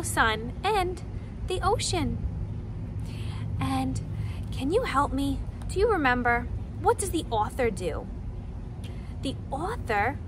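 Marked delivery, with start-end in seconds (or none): none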